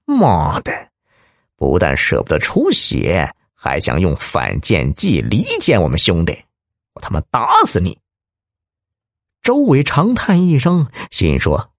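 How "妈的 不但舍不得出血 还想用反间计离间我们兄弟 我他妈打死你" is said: mild anger. Acted. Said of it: "顿时大怒".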